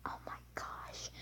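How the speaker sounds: soft breathy whisper of disbelief. Retold or imitated. Kid reacting to mom not hearing, hushed whisper. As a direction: breathe it under your breath. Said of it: "I'm like".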